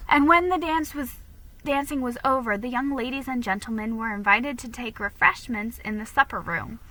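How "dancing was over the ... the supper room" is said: ordinary delivery, nothing marked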